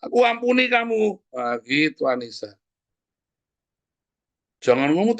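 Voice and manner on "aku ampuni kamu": forgive while still furious; angry pardon. Acted.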